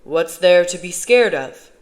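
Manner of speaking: loud, slow, booming question; dismissive disbelief. A narrator's reading. Father brushes off his son's concern, loud and dismissive.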